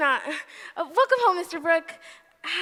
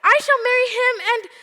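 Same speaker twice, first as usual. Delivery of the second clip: defiant; 5 semitones higher, 9 dB louder than usual. Acted. Meg defies aunt.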